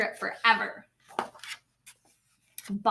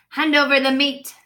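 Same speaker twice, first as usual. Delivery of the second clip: louder, gruff-ish pirate demand; lightly voiced greedy pirate; 8 dB louder than usual. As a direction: demand it at the table. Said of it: "Braid Beard gulped his food and said".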